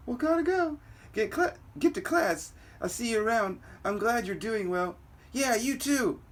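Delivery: high put-on girl voice; girl saying a quick goodbye before class. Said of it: "Oh, that's the girl".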